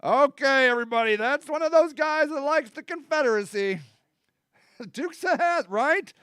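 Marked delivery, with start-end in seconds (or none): 0.0-3.8 mock alarm. Spotting a Confederate fan, warning everybody in mock alarm. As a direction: sound the alarm, half joking, to the room. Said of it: "is you're like".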